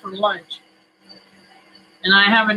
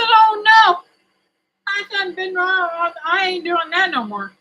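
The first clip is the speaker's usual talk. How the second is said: lightly voiced grandma, higher; a higher, put-on old lady voice; imitating grandma; 10 semitones higher than usual. Retelling what Grandma said to me, higher-pitched grandma. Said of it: "She said".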